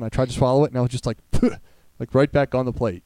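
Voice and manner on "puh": short puffed spitting noise; imitated spit. Retelling his failed swallow to friends, imitated spit. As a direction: mimic spitting food straight out.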